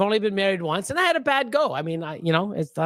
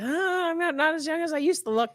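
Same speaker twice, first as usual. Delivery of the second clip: put-on high, light voice; high mock musing; 11 semitones higher than usual. Acting out an older customer being carded, high-voiced. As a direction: play it pitched well up, away from your usual voice.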